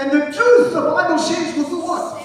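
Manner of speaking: high, exultant pulpit cry; full-force preaching. Real, not acted. Preacher promising congregation the truth frees them, full-force preaching. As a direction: proclaim it out to the crowd.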